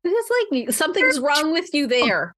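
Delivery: normal talking voice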